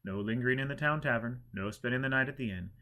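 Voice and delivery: plain reading voice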